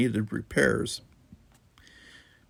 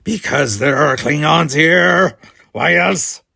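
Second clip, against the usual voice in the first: loud accusation; 6 semitones higher, 11 dB louder, slower than usual. Acted. Klingon hurling accusation.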